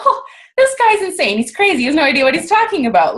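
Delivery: high-pitched, mock-outraged scoff; indignant disbelief. Patient retelling her reaction to the doctor, indignant. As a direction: dismiss him as crazy, pitch jumping up.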